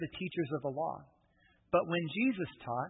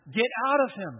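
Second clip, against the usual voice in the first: loud, high command; stern command; 6 semitones higher, 7 dB louder than usual. Retold or imitated. Jesus ordering the spirit out of the man, loud command. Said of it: "Jesus says".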